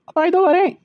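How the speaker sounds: high, loud call from another room; calling back. Acted. Daughter calls back from another room to father, loud and high.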